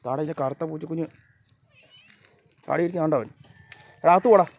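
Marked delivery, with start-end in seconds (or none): 4.0-4.5 raised, higher shooing call; real sharp command to a pet; shooing an animal. Real, not acted. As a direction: order the animal inside, loud and higher.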